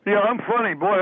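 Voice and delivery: ordinary talk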